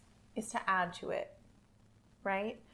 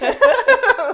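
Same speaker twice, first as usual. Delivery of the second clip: loud laugh; 19 dB louder than usual. Real, not acted. Laughing at her own dump truck joke, loud high laughter.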